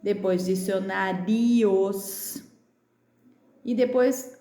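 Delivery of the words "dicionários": "dicionários" slowed, ending stretched as ri-os; slow, separated vowels at the end of "dicionários"; vowel-sound demo. Shown as a demonstration. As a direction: draw out the io in "dicionários". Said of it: "que tem vogais juntas".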